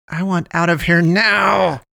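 slow, high-pitched angry demand; growled demand. A narrator's reading. Poe demands a way out from Damien, growling. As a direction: growl it slowly, pushing up in pitch. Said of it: "she growled".

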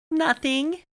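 put-on kid voice, very high; giggly little girl. A narrator's reading. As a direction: squeal it like a silly five-year-old. Giggling five-year-old answers her dad.

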